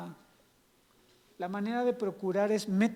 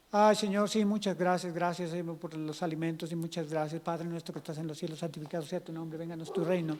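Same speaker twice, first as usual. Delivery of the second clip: low, quiet rote prayer; droned one-sided prayer, mock-pious; prayer monologue; 4 semitones lower, 4 dB quieter than usual. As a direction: rattle through grace without listening. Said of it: "tenemos un monólogo".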